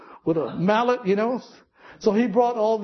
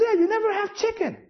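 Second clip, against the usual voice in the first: quoted voice of a woman pitched well higher; woman's voice; 13 semitones higher, faster than usual. Retold or imitated. Preacher quoting the church lady's complaint, pitched high. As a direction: lift it up high like the lady complaining.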